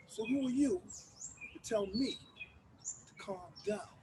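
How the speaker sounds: breathy whisper, almost silent. Acted. To the man telling him to calm down, a whisper. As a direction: drop to a bare whisper.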